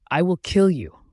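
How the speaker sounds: shrieked threat. A narrator's reading. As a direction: shriek it before attacking. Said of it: "she shrieks before lunging at me".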